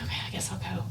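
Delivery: whispered, giving in reluctantly; resigned whisper. Retold or imitated. Giving in to her husband, whispered and resigned. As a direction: concede under your breath.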